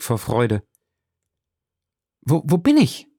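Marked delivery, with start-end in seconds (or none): 2.2-3.1 higher, breathless joyful cry; mother half mad with joy; overjoyed cry. A narrator's reading. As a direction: cry out in dizzy relief. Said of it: "rief sie".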